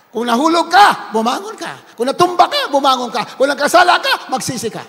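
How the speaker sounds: loud, rising preacher's call ending in a shouted Hallelujah; rally the church to get back up; preaching at full force. Acted. Preacher urging sinners to rise, full-force preaching.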